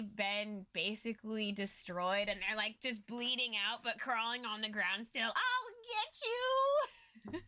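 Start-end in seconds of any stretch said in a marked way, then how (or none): 5.3-7.4 much higher, dragged-out villain wail; hammed-up dying villain scream; dying boss cry. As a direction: crawl and howl at the hero. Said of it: "end scene of a movie when the boss".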